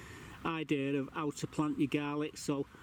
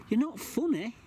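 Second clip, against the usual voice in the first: high, louder scold; 8 semitones higher, 4 dB louder than usual. Real, not acted. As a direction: tell the cat off sharply. Scolding the cat.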